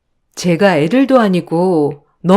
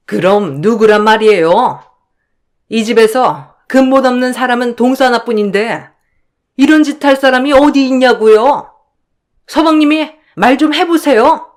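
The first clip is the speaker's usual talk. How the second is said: higher, accusing, jeering voice; sneering sister-in-law; 5 semitones higher than usual. Acted. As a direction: spit out the accusation at the younger in-law.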